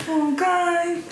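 usual speaking voice

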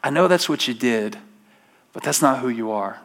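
soft, low and gentle, like a loving father; hushed fatherly comfort; tender voice of God. Retold or imitated. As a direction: reassure them quietly. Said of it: "God comes along and says".

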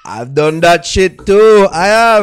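slow, high, very loud admission; loud confession. Real, not acted. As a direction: shout it out over the banter. Loudly confessing amid heated banter.

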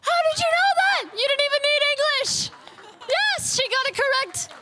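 high, rapid-fire astonishment; excited game-host disbelief; amazed. Real, not acted. Host amazed by volunteer's translation.

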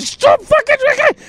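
very high strangled squeal; hammed-up high-pitched protest; mock helpless pleading. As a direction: squeal it as if laughing in pain. Retold helpless pleading while laughing.

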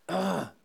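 mock groan of frustration; exasperated groan. Preacher voicing Jesus fed up with disciples, exasperated groan. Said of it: "Aber Jesus sagt so".

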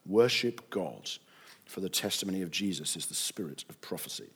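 low, soft, solemn reading; hushed scripture reading. A narrator's reading. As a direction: let the voice sink into quiet reverence. Reads angel's words in hushed voice.

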